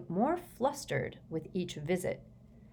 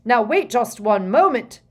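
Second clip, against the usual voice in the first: loud, raised protest; shouting; 5 semitones higher, 11 dB louder than usual. A narrator's reading. Prime Minister tries to stop Fudge vanishing, shouting. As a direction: shout to stop someone leaving. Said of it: "before the Prime Minister could shout".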